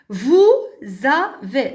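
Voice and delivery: "vous avez" in pieces, loud "vous", z on "avez"; liaison with z. Shown as a demonstration. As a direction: model "vous", pause, "avez" with a z. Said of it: "la même règle".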